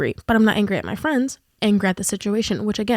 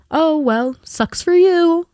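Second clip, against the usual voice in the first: high, louder, slow and sarcastic "sucks for you"; mock dismissive; 7 semitones higher, 5 dB louder, much slower than usual. Mocking how others would brush off her pain, sarcastic. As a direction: drag it out, mocking someone who shrugs her off.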